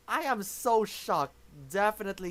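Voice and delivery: usual speaking voice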